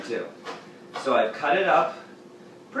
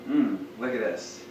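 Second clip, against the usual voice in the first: ordinary delivery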